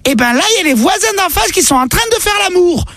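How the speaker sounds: put-on high little kid's voice; squeaky child answering mum.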